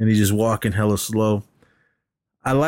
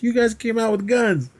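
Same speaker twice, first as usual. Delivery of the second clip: high mock complaint; 12 semitones higher than usual. Acted quote, complaining.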